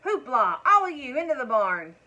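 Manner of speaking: loud, high call herding the cows; yelling. A narrator's reading. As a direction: bellow the cows home at dusk.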